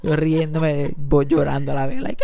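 ordinary delivery

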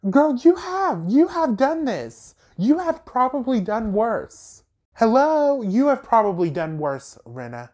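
real indignant rant at a TV star who did worse; exasperated outburst. Real, not acted. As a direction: call her out in disbelief, hitting every word.

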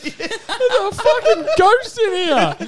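high, slow laugh-filled line. Real, not acted. Friend joking about the ghost, high and laughing. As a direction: crack up while saying it.

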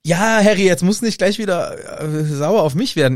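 genervtes Beschwichtigen. Acted. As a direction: play a fed-up friend calming someone down. Gespielte Hermine beschwichtigt genervt Harry. (genervt, erhöht, beschwichtigend)

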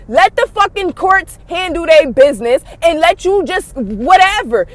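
ordinary delivery

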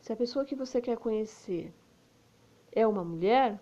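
ordinary talk, nothing marked

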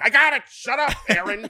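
high, loud, slowed mock snap; exasperated cutoff. Actor cutting off the quarterback's riff, loud mock exasperation. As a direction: shut him down in mock irritation.

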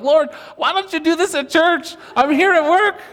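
high, loud whiny protest; mock complaint to God.

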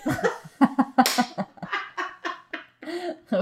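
long, high burst of laughter; helpless real laughing fit; big laugh. Real, not acted. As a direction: crack up at your own punchline. Laughs at own punchline.

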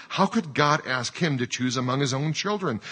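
usual speaking voice

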